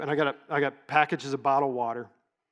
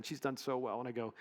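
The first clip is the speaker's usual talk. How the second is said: normal talking voice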